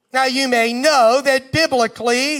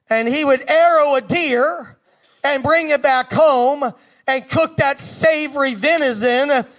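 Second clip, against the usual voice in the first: normal talking voice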